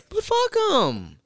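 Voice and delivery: high, slow singling out of each word; drawn-out dismissal. Real, not acted. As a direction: stretch it out high for comic emphasis.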